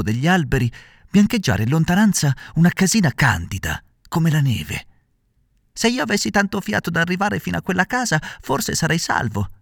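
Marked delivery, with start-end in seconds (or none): 5.7-9.5 higher, anxious puppet voice to himself; a frightened hope, said inwardly; Pinocchio thinking to himself. A narrator's reading. Puppet hoping to reach the house, higher inner voice. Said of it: "disse dentro di sé".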